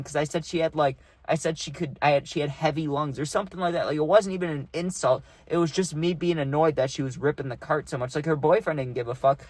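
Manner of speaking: ordinary talk, nothing marked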